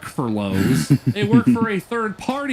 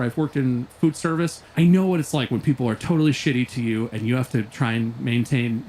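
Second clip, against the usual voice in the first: ordinary talk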